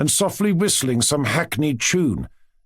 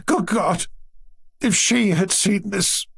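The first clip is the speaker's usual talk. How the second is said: high, slow cry of dismay; distressed grandmother near tears; tearful exclamation; 7 semitones higher, slower than usual. A narrator's reading. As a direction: exclaim in grief, drawing the words out. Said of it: "exclaimed Grandmamma".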